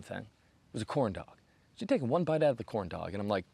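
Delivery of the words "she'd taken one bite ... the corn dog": ordinary talk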